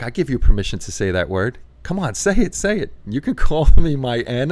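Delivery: loud high urging friend; mock coworker pressing him to say it. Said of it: "And he's like".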